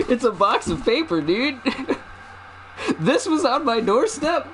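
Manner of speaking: high, laughing disbelief; half-laugh it out, baffled; incredulous laughing rant. Real, not acted. Streamer to chat, baffled by a box of paper.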